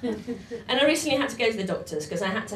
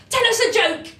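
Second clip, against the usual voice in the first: 1 eager demand; 12 semitones higher, 6 dB louder than usual. Retold or imitated. Excited Enid pleads for joke.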